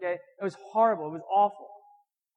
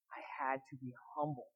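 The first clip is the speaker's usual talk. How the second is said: whisper; 6 semitones lower, 12 dB quieter than usual. Real, not acted. Confessing his own humbling.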